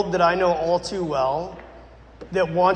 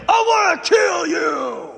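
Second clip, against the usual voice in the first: slow, high, loud possessed voice; possessed man shouting at the pastor; demonic threat; 9 semitones higher, 5 dB louder, slower than usual. Retold or imitated. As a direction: snarl it out like a demon.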